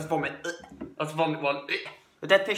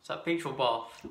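ordinary delivery

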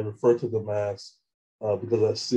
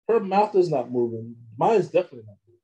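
normal talking voice

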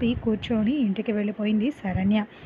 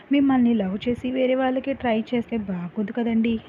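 ordinary delivery

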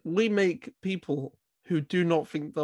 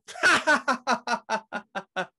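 loud, higher-pitched exaggerated cackle; demonstrated laugh; 6 semitones higher, 3 dB louder than usual. Shown as a demonstration. Co-host showing how he laughs at a mum joke, exaggerated loud laugh. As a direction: put on a big, long mock laugh. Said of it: "and then I go".

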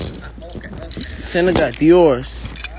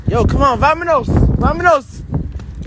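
calling out; 6 semitones higher than usual. Real, not acted. Calling crew to get moving.

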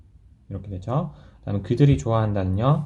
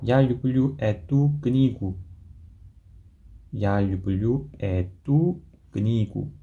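slow model sentence; much slower than usual. Shown as a demonstration. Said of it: "teacher models slow sentence".

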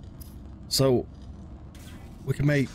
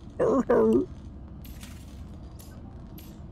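high breathy giggle; 13 semitones higher, 4 dB quieter than usual. Real, not acted. After getting the flashlight.

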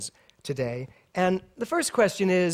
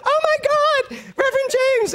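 put-on much higher, louder squeal of a thrilled teenage girl; excited teen girl; 8 dB louder than usual. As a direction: gush like a starstruck sixteen-year-old. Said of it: "she was like a sixteen-year-old girl".

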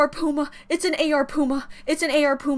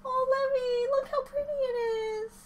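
cooing; 7 semitones higher, 3 dB quieter, slower than usual. Real, not acted. Cooing over gadget.

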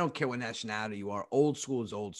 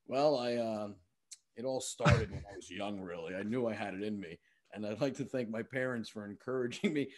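lower, self-important award-winner voice; mock acceptance speech; 3 semitones lower than usual. Comedian answers a shopper's congrats, mock acceptance speech. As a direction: deliver it like a humbly proud winner. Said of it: "Stop giving an acceptance speech".

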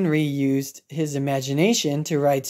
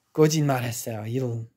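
plain reading voice

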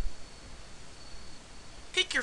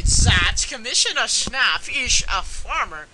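drawn-out put-on German accent, a bit higher; mock German accent; 4 semitones higher, 6 dB louder, slower than usual. Player mimicking supposed French dialogue, in a German-sounding accent. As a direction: stretch it out in a fake German accent. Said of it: "Sounds more like German".

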